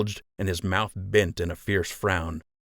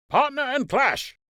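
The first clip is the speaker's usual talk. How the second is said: high, sustained drill call; called command. A narrator's reading. Lieutenant ordering soldiers to pair up, ringing call. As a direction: sing it out across the field. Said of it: "Drand called".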